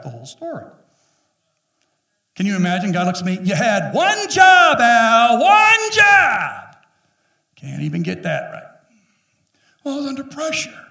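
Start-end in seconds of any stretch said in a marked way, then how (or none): 3.4-8.7 booming, drawn-out divine rebuke, much higher and louder; God scolding. Retold or imitated. God scolding the pastor at judgment, booming. 9.8-10.8 higher, meek little excuse; sheepish excuse. Retold or imitated. Pastor excusing himself before God, sheepish.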